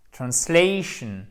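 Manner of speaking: "Translation" slowly with stretched, stressed SLAY; stress and diphthong. Shown as a demonstration. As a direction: lean on the ay in "Translation". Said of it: "bien l'accent tonique et bien la diphtongue".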